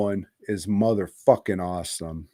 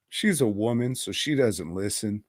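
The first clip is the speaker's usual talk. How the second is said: ordinary talk, nothing marked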